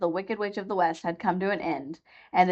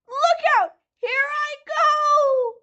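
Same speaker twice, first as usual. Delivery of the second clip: very high, louder, dragged-out witch cry; final wail; 4 dB louder, much slower than usual. A narrator's reading. Witch crying out as she melts, high and drawn out. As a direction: let the last words stretch away.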